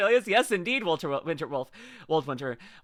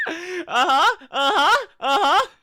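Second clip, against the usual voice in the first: mock crying; 9 semitones higher, 7 dB louder, slower than usual. Mock sobbing.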